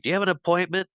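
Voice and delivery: high-pitched retold question; high, playful imitation of a boy; mock child's voice. Child at window voiced in retelling.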